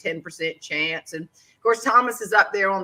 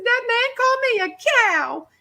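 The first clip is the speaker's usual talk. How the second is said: much higher, fast tearful wail; crying harder; 4 dB louder, faster than usual. Retold or imitated. Wife to husband Thomas, high fast tearful complaint. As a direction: wail it to your husband through tears. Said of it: "I was crying even harder".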